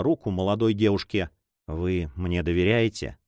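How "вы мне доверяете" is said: tender low question; meek, lower and soft. A narrator's reading. Philippe gently asking Juana for her trust, meek and low. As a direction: ask gently and humbly, lower in the voice. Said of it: "сказал он кротким голосом".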